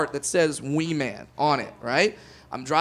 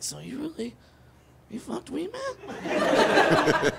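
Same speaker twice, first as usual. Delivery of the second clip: hushed incredulous; 8 semitones higher, 6 dB quieter, much slower than usual. Retold or imitated. Sneaky whispered question to girl.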